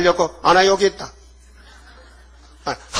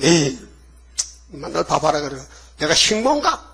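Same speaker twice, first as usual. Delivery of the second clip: mock grumbling; 4 dB quieter than usual. Grumbling church worker imitated.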